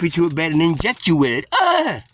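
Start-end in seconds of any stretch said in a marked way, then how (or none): 1.5-2.0 high loud shriek of alarm; mock shriek. Reacting to the threat of being strapped down, high shriek. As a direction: yelp at the threat.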